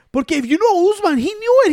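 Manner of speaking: talking fast and pumped about the champ; excited. Real, not acted.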